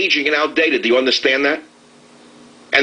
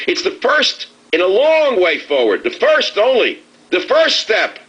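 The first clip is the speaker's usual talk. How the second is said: mocking Obama; 9 semitones higher, slower than usual. Host ridiculing the president's phrasing, high mocking imitation. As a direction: parrot his words in a high sneering mimic. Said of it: "Obama said today also that".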